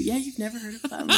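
giggling through the words; laughing. Real, not acted. Backpedals laughing.

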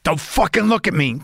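high, strained menacing Dennis Hopper growl; menacing, unhinged gangster voice; Dennis Hopper impression. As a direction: snarl it like Hopper in Blue Velvet. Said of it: "Dennis Hopper in Blue Velvet".

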